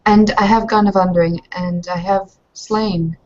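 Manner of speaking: ordinary delivery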